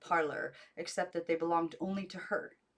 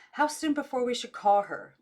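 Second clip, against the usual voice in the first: distant voice; 7 semitones higher, 8 dB louder than usual. A narrator's reading.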